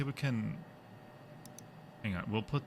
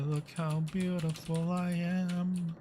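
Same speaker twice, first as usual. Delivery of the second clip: higher, preening vain voice; 7 semitones higher than usual. Pretend. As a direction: show off like a vain beauty. Voicing vain character.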